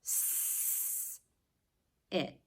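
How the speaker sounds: teacher segments the sounds; sounding out. Shown as a demonstration. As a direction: split sit into s and it. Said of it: "I put together".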